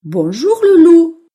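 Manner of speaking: high, loud, drawn-out mouse greeting; cheerful little mouse. Acted. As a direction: call slowly to the wolf as a mouse. (petite souris qui salue fort)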